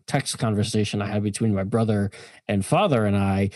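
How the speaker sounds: ordinary talk, nothing marked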